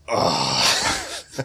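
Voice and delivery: exhausted groan. Retold or imitated. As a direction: groan like you're done with the world. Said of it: "Ben Affleck meme ... going".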